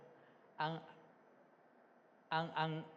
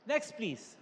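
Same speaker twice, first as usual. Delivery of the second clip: ordinary delivery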